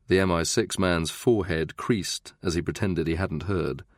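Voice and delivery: ordinary delivery, nothing marked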